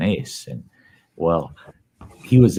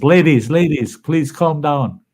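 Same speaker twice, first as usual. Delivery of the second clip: higher, louder mayor at the mic, slowing on calm down; mock-official plea to settle the ladies; mayor calming crowd; 7 semitones higher, 4 dB louder than usual. The mayor at the mic calming the ladies, raised voice. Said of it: "The mayor steps up to the mic and says".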